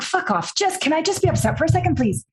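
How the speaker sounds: high, fast, whiny protest; mock exasperated snap. To her coach, fast mock exasperated whine. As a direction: plead to be left upset, fast and high.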